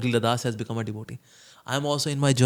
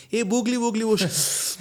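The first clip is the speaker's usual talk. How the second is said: put-on higher, playful baby-talk teasing; affectionate teasing; 8 semitones higher, slower than usual. Friend teases someone about a pimple, playful higher coo. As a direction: coo at him fondly while poking fun. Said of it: "out of affection, somebody says".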